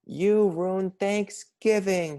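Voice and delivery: building from high to a loud furious shout, slow; angry shout. A narrator's reading. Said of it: "he shouted".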